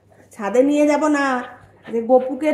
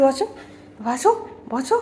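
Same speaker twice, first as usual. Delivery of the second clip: usual speaking voice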